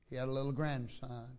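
usual speaking voice

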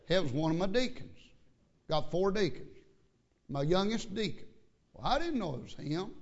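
ordinary talk, nothing marked

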